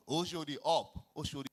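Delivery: loud bus-stop shout of Oshodi up; conductor's shout. Retold or imitated. Conductor shouting for passengers at the bus stop, loud call. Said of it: "That's how you have to shout".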